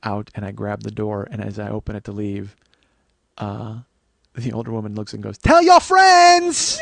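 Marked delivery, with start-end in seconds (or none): 5.4-6.8 loud, high, drawn-out falsetto call; hammed-up high sing-song yell; cheerful shout. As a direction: belt it like a cheery sales pitch. Punchline shouted by older woman.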